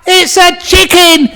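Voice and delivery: very high, loud, building yell; hammed-up shouting match, each word stretched; quarrelling shout. Husband and wife contradicting each other, shouting angrily.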